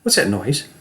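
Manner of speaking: wary and uneasy; afraid. Shown as a demonstration. Acting coach demonstrates fear for students. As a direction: hear a noise in the night. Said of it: "I want you to watch me".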